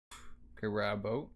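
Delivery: usual speaking voice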